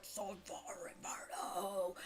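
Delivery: slow whispered imitation of a shout; tiny faraway yell. Game master echoing a tiny witch's yell, whispered. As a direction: whisper a drawn-out battle cry. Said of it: "at the size of the four of you, it sounds like".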